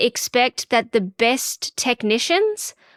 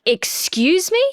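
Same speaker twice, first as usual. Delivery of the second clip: mock-indignant, higher-pitched question; affronted disbelief; 8 semitones higher, slower than usual. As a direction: imitate an appalled "how dare you" query. Retold shock at door barged open.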